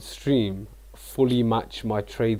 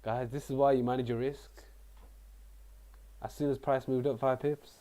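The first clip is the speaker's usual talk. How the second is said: softly attempted Canadian accent; poorly imitated Canadian streamer accent; 11 dB quieter than usual. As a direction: have a quiet, unsure go at a Canadian accent. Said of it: "I can't replicate the accent".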